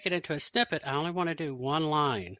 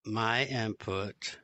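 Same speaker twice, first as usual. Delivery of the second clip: dictating; 4 semitones lower, 3 dB quieter, much slower than usual. Real, not acted. Dictating slowly to speech software.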